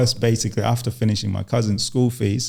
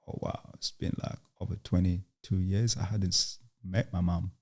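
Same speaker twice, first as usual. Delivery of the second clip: a barely voiced, much softer thought to herself; hushed inner thought; 2 semitones lower, 10 dB quieter than usual. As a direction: murmur it like a private thought, half whispered. Inner thought on hearing news.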